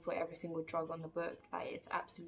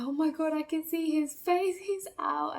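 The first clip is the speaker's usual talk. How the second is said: high, loud thrilled announcement; imitating boyfriend's loud excitement; excited boyfriend; 10 semitones higher, 11 dB louder than usual. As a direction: call it out high and overjoyed.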